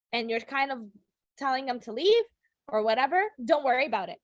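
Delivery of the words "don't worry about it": curt brush-off, falling at the end; standoffish. Shown as a demonstration. Teacher shows shutting someone off, standoffish. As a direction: shut them off as if mad. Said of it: "if you're shutting someone off".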